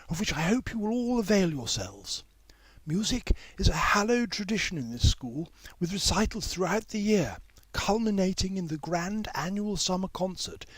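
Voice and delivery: high, gracious headmistress speech; Miss Lomax welcoming. A narrator's reading. Miss Lomax welcoming the newcomers, high gracious speech. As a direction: address the new boys grandly, high and formal.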